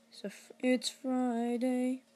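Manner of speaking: high, slow, drawn-out Friday chant; sing-song slogan voice. Pretend. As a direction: stretch it out like a catchphrase.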